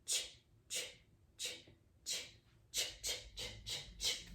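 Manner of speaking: "Ch" said soft and unvoiced over and over; ch sound. Shown as a demonstration. Teacher sounds out ch for the kids quietly. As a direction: whisper the ch sound in quick bursts. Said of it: "Do we hear ch".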